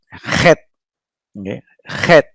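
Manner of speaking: het said with a rough h from the throat, like khet; guttural het. Shown as a demonstration. Hebrew teacher showing students the guttural het sound. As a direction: scrape het from the back of the throat. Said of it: "this is not het, no, but is het".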